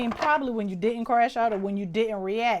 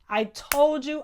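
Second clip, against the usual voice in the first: loud told-you; 6 semitones higher, 6 dB louder, slower than usual. Real, not acted. Repeating I told you, fading.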